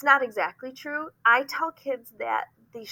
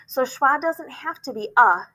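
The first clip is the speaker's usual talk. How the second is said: ordinary talk, nothing marked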